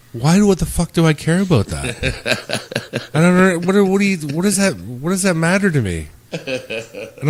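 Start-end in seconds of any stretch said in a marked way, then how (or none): 0.1-6.1 louder, higher retelling of an exasperated comeback; retold indignation. Retelling his reply to ex's friend on the phone, indignant. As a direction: act out your baffled, indignant reply.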